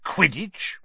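voiced, rising, disbelieving "Quidditch?"; incredulous. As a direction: repeat "Quidditch?" aloud with a sharp upward question. Uncle repeats strange word aloud.

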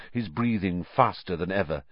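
usual speaking voice

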